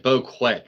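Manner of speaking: Boquet said as "bo-KWET"; hard "kwet" ending on Boquet; mispronounced bouquet. Shown as a demonstration. Streamer playing with words for chat, Boquet as "bo-KWET". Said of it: "Or you could pronounce it".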